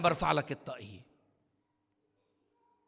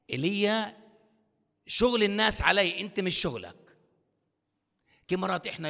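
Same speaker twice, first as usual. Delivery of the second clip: normal talking voice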